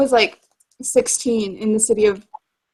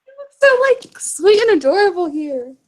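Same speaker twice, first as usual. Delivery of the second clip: gushing; 8 semitones higher than usual. Real, not acted. Friend cooing at a cute character picture, high and gushing. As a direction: pitch jumps high on so, then stays bright and gushing.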